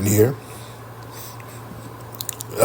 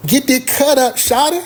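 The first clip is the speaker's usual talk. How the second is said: mimicking girlfriend; 12 semitones higher than usual. Girlfriend voiced, much higher.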